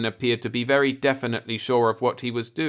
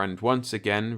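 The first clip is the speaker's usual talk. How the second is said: ordinary delivery, nothing marked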